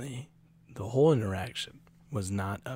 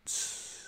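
high hissing can opening; soft imitated can hiss; 8 dB quieter than usual. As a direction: imitate a can cracking open. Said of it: "you crack it open and it goes".

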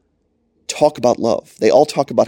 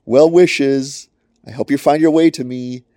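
louder, higher, breezy call down from heaven; mock shout from heaven; 5 semitones higher, 4 dB louder than usual. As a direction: call out sweet nothings from the sky, hammed up. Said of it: "if he just shouted from heaven".

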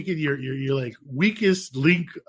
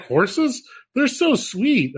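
a hint of the wife, pitched higher and slower; lightly voiced wife; 8 semitones higher, much slower than usual. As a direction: mimic the wife's sweet gushing. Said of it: "She's like".

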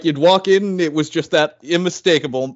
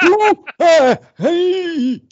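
high squeaky put-on voice; mock character voice; 14 semitones higher, 5 dB louder, much slower than usual. Friend riffing on the movie character, squeaky and high. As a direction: pipe it up like a cartoon.

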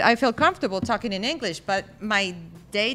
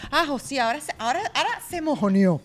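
mocking Maracucho followers; 3 dB louder than usual. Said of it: "me vayan a decir".

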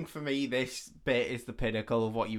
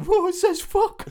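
put-on high, loud, drawn-out kid amazement; hammed-up child voice, thrilled by a swear word; mock eleven-year-old; 9 dB louder, slower than usual. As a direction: imitate an awestruck schoolkid. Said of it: "like, an eleven-year-old who's like".

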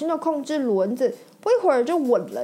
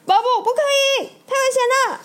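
much higher, louder warning cry; alarmed shout; 6 dB louder, much faster than usual. Acted. McQueen shouting a warning at Bob, alarmed. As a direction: yell to stop him before he hurts someone. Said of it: "麦昆大叫".

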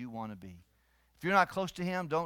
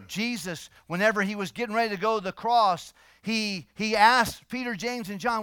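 loud, raised-pitch sermon voice; full-force preaching; 5 semitones higher, 8 dB louder than usual. Real, not acted. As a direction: drive the point home to the congregation.

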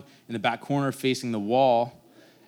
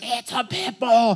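high, slow, shocked mom; 10 semitones higher, 4 dB louder, slower than usual. Retold or imitated. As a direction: drag it out in alarm like mom. Mom spotting the new dog.

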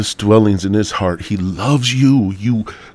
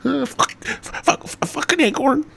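high squeaky blurted curses; Tourette's tic impression; slower than usual. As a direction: spit the swears out high as involuntary tics. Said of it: "watch some motherfucking Tourette video".

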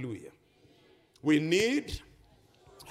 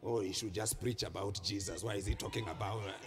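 low, soft mock grumble; low muttered complaint, imitated; grumbling critic; 11 semitones lower, 7 dB quieter, faster than usual. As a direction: imitate a disapproving churchgoer under the breath. Mimics grumbling congregation members.

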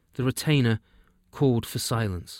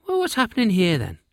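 a much higher, louder little elf voice; wee elf king; 10 semitones higher, 5 dB louder than usual. Acted. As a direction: pipe up like a tiny elf king arriving.

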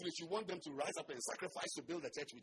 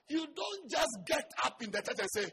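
preaching shout; 9 semitones higher, 10 dB louder than usual. Real, not acted. Preaching at full force.

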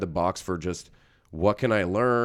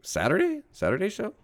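high, rising disbelief; can't believe it's Saturday; shocked question; 11 semitones higher than usual. Acted. Moviegoer hears his ticket was for Saturday, high and disbelieving.

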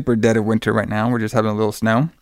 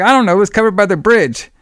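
much higher, a bit louder, frightened; scared answer; 9 semitones higher, 3 dB louder than usual. Retold or imitated. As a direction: blurt it out in fear. Scared girl answering on phone.

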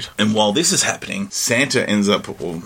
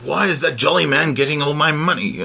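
alien invader voice; 7 semitones higher than usual. Retold or imitated. Zim's outraged quote.

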